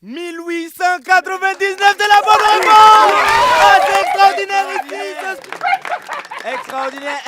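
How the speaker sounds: high, shouted burst of amazement; ecstatic announcement. Acted. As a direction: yell the winning answer like a jackpot. Host thrilled by a right answer. (cri de triomphe exalté, aigu et très fort)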